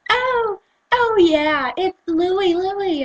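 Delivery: high, airheaded imitation; mocking a corrected girl. Girl being corrected on the name, high mock realisation. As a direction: mimic her in a high voice.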